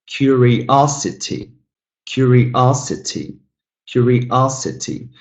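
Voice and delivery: "curiosity" slowly by syllables, OS stressed; slow teacher demo of "curiosity", third syllable strongest. Shown as a demonstration. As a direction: stretch out "curiosity", leaning on OS. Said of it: "the third one is stressed".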